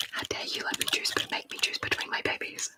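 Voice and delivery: ordinary talk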